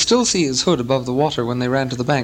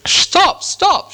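loud, high-pitched cry to halt; two high, forceful yells; urgent shout; 5 dB louder than usual. A narrator's reading. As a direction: shout it sharply to stop them, pitch leaping up. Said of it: "shouted Thorin".

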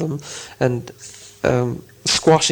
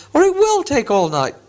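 irritated troll; 12 semitones higher than usual. Acted. Bert scolding William about arguing, high troll voice. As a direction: tell Bill off wearily. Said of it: "he said".

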